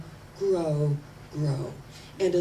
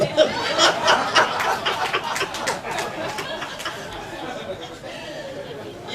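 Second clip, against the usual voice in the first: long breathy high laugh; 10 semitones higher, 5 dB louder than usual. Real, not acted. Preacher laughing at own joke before congregation, long and high. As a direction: let the laugh ride.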